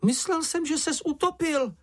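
very high-pitched, distressed wail; panicked cry. A narrator's reading. Čenich thought Mumínek had drowned, high panicked cry. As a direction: cry out in a small frantic voice. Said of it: "křičel Čenich".

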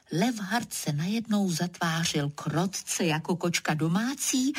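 plain reading voice

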